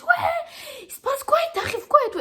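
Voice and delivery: high, loud shocked cry with a laugh; mother's shocked reaction to her bleeding daughter. Retold or imitated. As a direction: play the mother's alarmed disbelief. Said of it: "Elle était choquée".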